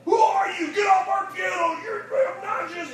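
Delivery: put-on loud, high, furious shout at the kid; janitor yelling. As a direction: bark it like an angry janitor. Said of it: "walk up and say".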